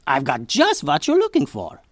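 high, loud excitable scientist; eccentric inventor. A narrator's reading. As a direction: ramble like a proud inventor. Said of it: "he said".

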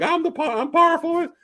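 high bragging goblin squeak; high mock boast.

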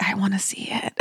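unvoiced, breathless whisper of longing; eager whisper. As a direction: hush it like a secret wish. Longing to see the Broadway show.

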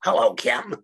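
creepy whispered clown voice; breathy sinister clown greeting; Pennywise voice. Retold or imitated. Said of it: "that was tremendous in its accuracy".

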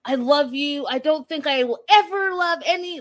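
lightly hammed-up false lover; mock liar. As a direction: gush like a smooth-talking liar. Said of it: "the lies just roll out".

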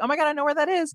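high-pitched retold excitement; excited recognition. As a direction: re-enact a thrilled moment of recognition. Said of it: "When they said it, I was like".

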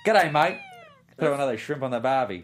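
mock Aussie tourist line; Australian accent. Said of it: "I wanted to hear your Australian accent".

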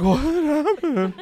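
slow, high, laughing dog voice; confused dog. Pretend.

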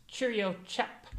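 slow, lightly put-on British "Cheerio, chap"; drawn-out British accent; mock British accent. Correcting a friend with a slow British accent. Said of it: "Um, no".

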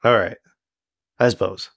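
mildly deflated, trailing away in pauses; understated disappointment; crestfallen. Acted. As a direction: give in haltingly, a touch let down. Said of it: "just kinda get kinda crestfallen".

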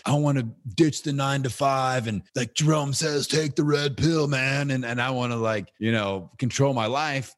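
mocking wannabe entrepreneurs, a touch pumped. Mimicking entrepreneurs who want to quit their jobs, mildly hyped. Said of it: "all these entrepreneurs out there are like".

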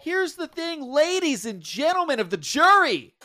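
loud, high lawyer addressing the jury; passionate closing argument. Pretend. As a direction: deliver it like a courtroom closing. Mock courtroom address to jury.

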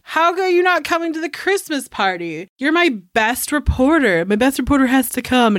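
high-pitched retold boss protesting; insistent boss. Boss pressing his best reporter to attend, insistent. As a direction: imitate an incredulous, insistent boss.

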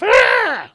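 high, loud yelled "ah"; mock war yell. Cotton yelling at the manager, hammed-up battle yell. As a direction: let out a wild battle cry.

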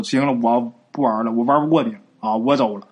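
low-pitched, subdued surrender; conceding defeat. A narrator's reading. Second uncle quits wrestling the giant, meekly giving up. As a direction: give up, dropping pitch and energy. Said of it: "这回二叔认怂了".

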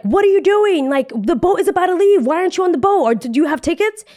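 high-pitched, incredulous official's voice; urgent cruise director. Retold or imitated. Cruise director finding passengers off the departing boat, urgent and incredulous. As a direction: imitate a baffled woman hurrying people along. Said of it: "In English Italian, she's like".

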